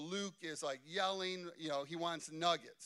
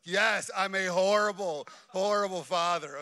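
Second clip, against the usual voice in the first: mock confession; 10 dB louder than usual. Mock self-accusation to the congregation.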